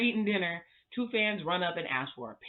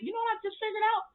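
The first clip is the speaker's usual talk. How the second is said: sudden realization; faster than usual. Real, not acted.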